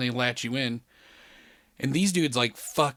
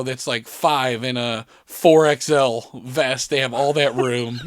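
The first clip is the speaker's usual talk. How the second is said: ordinary talk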